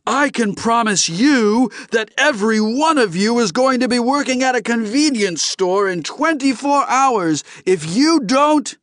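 high, loud furious tirade; enraged alien bellowing without let-up; bellowed threat. Acted. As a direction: threaten them all at the top of your voice. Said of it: "Lars Wingetog bellowed".

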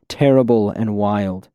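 usual speaking voice

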